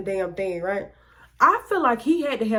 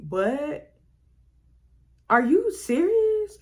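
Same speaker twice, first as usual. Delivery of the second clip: high, slow, stunned disbelief; 7 semitones higher, much slower than usual. Retold or imitated. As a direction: stretch it out high like you can't believe it. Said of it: "So now I'm like".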